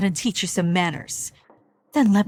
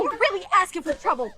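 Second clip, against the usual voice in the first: high-pitched heated warning; angry threat; 9 semitones higher than usual. Acted. Mother to one who talks back, angry threat. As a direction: threaten them in a raised pitch.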